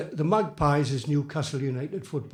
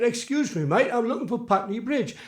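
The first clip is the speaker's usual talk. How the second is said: higher-pitched put-on London accent; imitated London accent; 7 semitones higher than usual. Visitor asking directions as coached, mock London accent. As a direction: try out a coached London accent, "Putney" as "Patney". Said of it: "You've got to say Putney Bridge".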